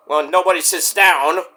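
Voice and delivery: normal talking voice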